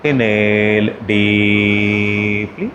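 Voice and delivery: very slow, near-chanted on one level tone; sustained monotone with a lift at the end; drawn-out breathing cue. Shown as a demonstration. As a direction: stretch each word to the length of a long inhale. Paces a long breath before chanting.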